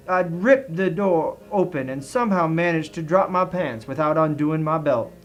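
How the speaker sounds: ordinary delivery, nothing marked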